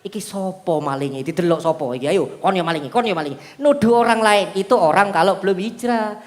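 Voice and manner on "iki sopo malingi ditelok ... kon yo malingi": much lower, softer mock accusation; accusing victim. Preacher mocks a pickpocket victim accusing passengers, low mock accusation. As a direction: point the finger at everyone. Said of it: "dia kan begini".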